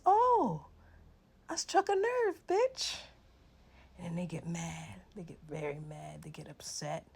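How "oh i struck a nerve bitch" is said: triumphant taunt. Retold or imitated.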